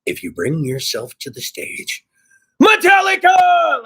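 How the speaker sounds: rumble, then belt the band name; announcer. Retold or imitated. A stage host introducing the band, mock announcer. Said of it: "some guy on stage going".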